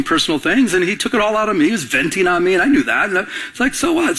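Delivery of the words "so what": high-pitched, light shrug; dismissive. Acted. Pastor shrugging off being vented on, dismissive. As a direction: toss it off as no matter.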